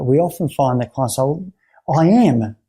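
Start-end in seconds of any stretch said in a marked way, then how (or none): 1.9-2.6 mildly frustrated, raised pitch; mock frustration. Client answering the repeated question, mildly frustrated. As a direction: insist on it with a touch of exasperation. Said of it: "in that frustration".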